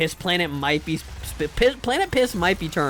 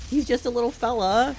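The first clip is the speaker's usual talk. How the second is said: high-pitched cooing over a kitten; doting pet-owner voice; 7 semitones higher than usual. Real, not acted. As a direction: coo fondly in a raised voice.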